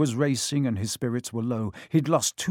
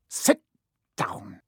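snapping; 5 semitones lower than usual. A narrator's reading. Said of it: "he snapped at Hermione".

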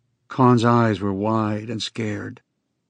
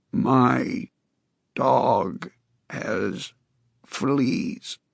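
halting, rasping word by word; hint of a rusty croak; strange grating voice; 4 dB quieter, much slower than usual. A narrator's reading. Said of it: "Con said in his strange, grating voice".

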